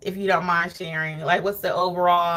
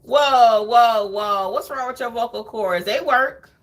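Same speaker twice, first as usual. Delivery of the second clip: loud mock call-out; 2 semitones higher, 5 dB louder, slower than usual. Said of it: "I'm like".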